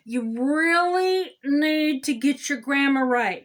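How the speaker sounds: slow lecture with "really" stretched out; emphatic scolding. Pretend. Council member scolding residents about grammar, "really" drawn out emphatically. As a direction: drag out "really" and "need" to drive it home.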